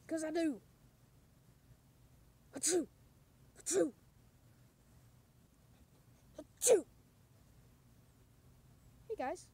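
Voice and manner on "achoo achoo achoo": building sneezes, last one loud; fake sneezes. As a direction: sneeze three times, growing. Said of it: "I have the sneezes".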